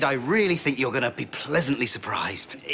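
ordinary talk

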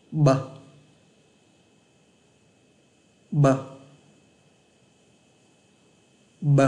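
"B" with lips lightly pressed and slowly released; soft-pressed B sound. Shown as a demonstration. Teacher models sound two for repeating, "B" lightly pressed, released slowly. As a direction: press gently and let "B" out as a short voiced sound. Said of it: "Ấn nhẹ, nhả chậm".

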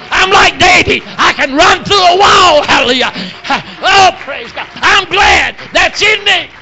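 loud, high, shouted exhortation; full-force preaching. Real, not acted. Preacher urging the weary congregation to praise God, full-force preaching.

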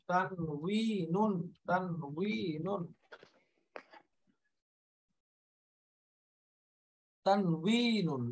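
তানউইনুন drawn out slowly, long "wee" then "nun"; Arabic pronunciation. Shown as a demonstration. Teacher showing learners the Arabic word, slow Arabic pronunciation of তানউইনুন. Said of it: "আরবি উচ্চারণটা হলো এরকম".

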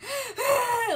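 a high, loud sharp gasp before crying; mock gasp before a hard cry. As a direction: imitate a dramatic gasp. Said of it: "she always did this thing where she went".

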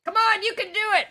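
high, quick pep talk; playful cheering-on. Pretend. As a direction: egg on the balky software. I like to talk to technology.

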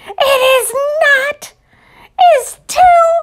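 put-on high, loud childish squabbling; shrill quarrel. Ruthie and Martin bickering over the camera, shrill. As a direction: squabble like a kid who won't back down.

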